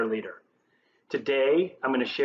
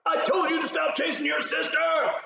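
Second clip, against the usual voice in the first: high-pitched parental yell; acted exasperated parent shouting; yelling at a kid; 3 dB louder than usual. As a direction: shout it across the house at your misbehaving child. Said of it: "after you yell at your kid".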